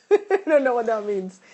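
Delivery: loud laugh running into the words; real laughing. Real, not acted. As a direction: laugh out loud while admitting confusion. Amused by viewer's puzzling comment.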